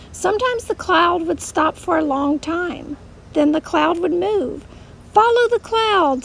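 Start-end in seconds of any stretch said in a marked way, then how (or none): 5.1-6.2 put-on higher, louder call for children; storybook shout. A narrator's reading. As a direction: call out to the crowd, rousing them to move. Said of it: "someone would shout".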